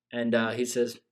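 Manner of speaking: ordinary talk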